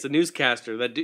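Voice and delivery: ordinary delivery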